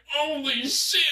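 very high, stunned exclamation; retold shock. Recounting his reaction to the neck remark, shocked. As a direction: squeal it in mock disbelief.

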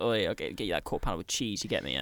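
mock drug dealer. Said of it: "We're pretending to be drug dealers today".

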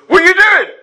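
high, loud disbelieving cry at the invaders; indignant bystander shouting at monsters; incredulous challenge. Pretend. Random bystander confronts invading powers.

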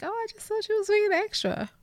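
high mock-dopey boyfriend voice. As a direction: mimic him as clueless. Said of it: "and you're like".

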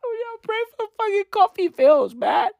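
high, laughing mock plea; laughing while talking. Real, not acted. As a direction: laugh through the words. Mocking repeat of own line.

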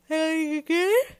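big audible yawn; yawning out loud. Real, not acted. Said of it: "And I'm yawning again".

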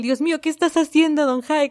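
hammed-up embarrassed outburst; flustered exclamation. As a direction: act out the embarrassed friend in disbelief. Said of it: "una vergüenza en el".

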